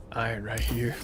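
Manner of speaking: ordinary talk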